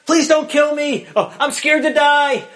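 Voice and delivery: high, loud, cowardly wail; hammed-up terrified crying out. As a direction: plead for your life like a screaming little kid. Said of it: "he snivels and he cowers and he cries out".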